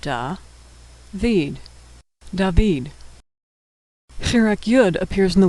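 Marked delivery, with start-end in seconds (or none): 0.0-1.6 syllable by syllable. Shown as a demonstration.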